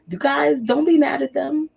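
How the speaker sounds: usual speaking voice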